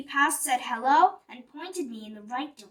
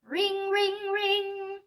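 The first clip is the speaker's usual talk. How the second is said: bell imitation; 7 semitones higher, much slower than usual.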